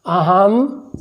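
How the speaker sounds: isolated words, long pauses between; word-by-word dictation. Shown as a demonstration. Teacher dictating Pranav's answer to students, spaced word by word. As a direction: give each word alone for copying down.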